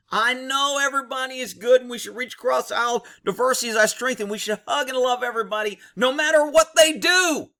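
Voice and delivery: mocking idealism. Jaded cop parodies love-everybody talk, mocking idealism. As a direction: parody a gushing idealist, stretching the last phrase.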